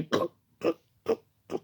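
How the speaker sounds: unvoiced imitated crunching; repeated toneless "cr" chomping sounds; munching noise. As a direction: mimic quick bites with dry throat clicks. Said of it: "comme ça, il l'a mangé".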